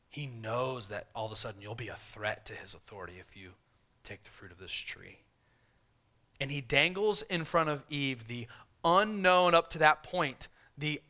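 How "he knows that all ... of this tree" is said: soft, hushed, insinuating tempter voice; serpent's whisper. Retold or imitated. Preacher voicing the devil tempting Eve, softly and slyly. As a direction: lure her in with a quiet, coaxing hush. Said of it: "the devil, Satan, saying".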